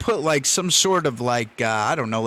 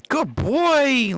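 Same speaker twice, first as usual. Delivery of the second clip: squeaky praising a dog; 11 semitones higher than usual. Real, not acted.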